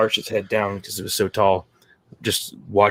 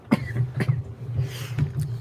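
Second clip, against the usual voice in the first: coughing fit; 8 dB quieter than usual. Real, not acted. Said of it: "I just got a choking fit".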